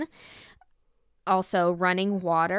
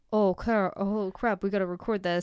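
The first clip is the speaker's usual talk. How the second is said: lightly voiced mom, barely deeper than usual; a hint of a chill mother. Daughter imitating her mom on a podcast, mock deep voice. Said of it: "Why does your mom have such a deep voice?".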